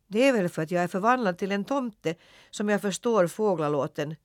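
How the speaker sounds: usual speaking voice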